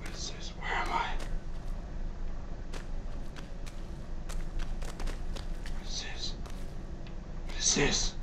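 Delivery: hushed and uneasy; nervous whisper. Real, not acted. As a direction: whisper so nothing hears you. Creeping through dark area.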